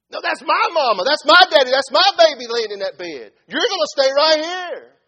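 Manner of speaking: very loud, high-pitched shouting; fierce parental protest. Acted. Parent to doctor over a sick child, fierce shouting. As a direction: roar it at the doctor, claiming your own.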